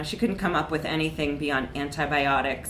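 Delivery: ordinary delivery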